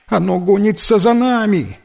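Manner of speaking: put-on high-pitched frightened child; squeaked. A narrator's reading. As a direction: squeak in alarm, stretching привидение. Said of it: "пропищала девочка".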